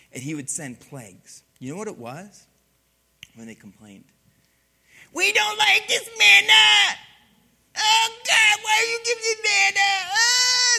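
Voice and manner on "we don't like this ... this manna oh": very high, loud hammed-up wail; crying and complaining. Israelites complaining to God about manna, mock crying wail. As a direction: whine it like a bawling toddler. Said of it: "they cried and they complained".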